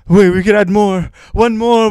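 high, loud acted pleading; desperate movie plea. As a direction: plead loudly as a film hero, strained upward. Acts out desperate Schindler scene.

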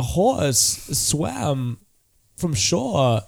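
halting delivery with odd pauses; swooping, stop-start cadence; Christopher Walken. Acted. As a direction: speak in broken phrases, each peaking then dropping. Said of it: "it's the most annoying cadence".

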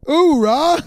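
loud gung-ho soldier cheer. Acted. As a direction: belt it out like a soldier. Cheer after club name.

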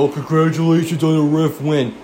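smug, gloating jab at the fan; mocking troll quote. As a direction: sneer it like a gloating rival fan. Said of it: "and they go".

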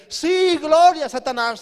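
high, loud acclamation; cheering demons. Acted. Uncomprehending demons cheering their master, shouted praise. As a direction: hail the master at full voice. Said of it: "los demonios que no lo entiendan dicen".

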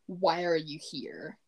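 ordinary delivery

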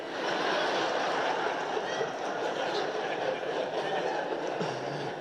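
long unvoiced laugh at his own joke; shaking with quiet laughter; breathy silent laugh. Real, not acted. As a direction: let out a soundless wheezing laugh.

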